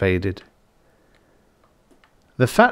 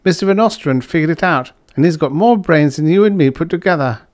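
eager defense; 9 semitones higher, 5 dB louder, faster than usual. A narrator's reading. Giles defends boss to Bob.

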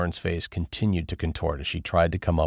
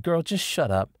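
Dion's voice; 11 semitones higher than usual. A narrator's reading. Dion voiced, high.

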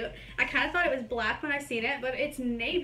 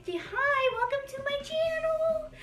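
high coaxing pet-talk voice; high baby-talk voice; 13 semitones higher than usual. Pretend. To her pet, a high coaxing voice. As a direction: coo it up high like talking to a toddler.

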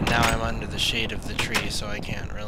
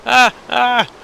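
panicked scream; 13 semitones higher, 16 dB louder than usual. Real, not acted. Panics at creeper below.

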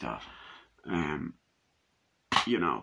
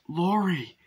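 high, drawn call of a name; lightly acted searching call, pitched up; imitated calling out; 8 semitones higher than usual. Woman looking for Laurie down the corridor, high mock call.

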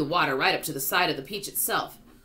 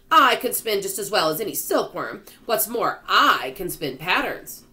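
loud, proud, higher voice; affronted and emphatic; indignant boasting; 5 semitones higher, 9 dB louder than usual. A narrator's reading. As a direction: boast with emphasis on your own skill. Miss Spider boasts indignantly.

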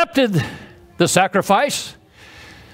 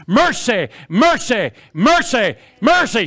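higher, rhythmic cries, each falling; preached cry of mercy; 3 semitones higher, slower than usual. Acted. Preacher voicing the blood of Jesus crying, insistent chant. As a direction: cry it out in a steady repeated beat.

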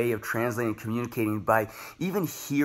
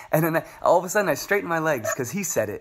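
ordinary delivery

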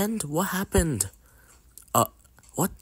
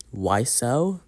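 normal talking voice

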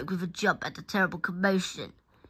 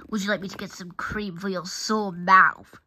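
usual speaking voice

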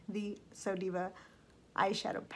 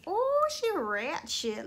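sassy; 7 semitones higher, slower than usual. Pretend. Sassy voice for the lip gloss.

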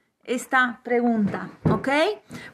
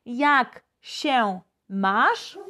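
very slow, each sound laid out for the class; slow teacher demo of a Polish greeting; slow model phrase; much slower than usual. Shown as a demonstration. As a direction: drawl it out so learners can copy. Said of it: "pronuncia conmigo".

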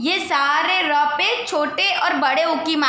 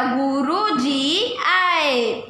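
Guru drawn out slowly, each syllable clear; slow reading aloud; much slower than usual. Shown as a demonstration. Teacher modelling a phrase to young learners, slow. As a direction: spell it out in sound for the kids. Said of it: "उ की मात्रा".

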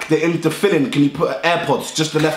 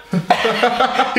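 short laugh; 5 semitones higher than usual. Real, not acted. Laughing about the prank.

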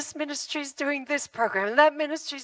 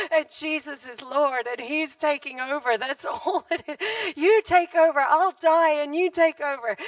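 ordinary talk

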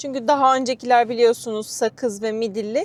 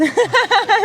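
short laugh; 9 semitones higher, 6 dB louder than usual. Real, not acted. Greeting the channel, laughing.

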